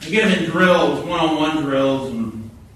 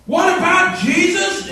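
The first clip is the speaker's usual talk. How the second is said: indignant challenge; 13 semitones higher, 4 dB louder, slower than usual. Retold or imitated.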